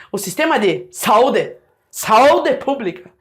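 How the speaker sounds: SAÚDE with a-u merged into SAU, stressed on SAU; wrong diphthong. Shown as a demonstration. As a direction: say SAÚDE as SOW-de, the u swallowed. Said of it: "você ia falar o quê?".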